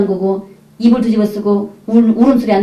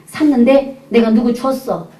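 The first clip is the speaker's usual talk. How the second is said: put-on drawn-out, careful admission to a child; slow confession; slower than usual. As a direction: break it to the child slowly. Mother confesses to her child. (조심스럽게 천천히 털어놓듯)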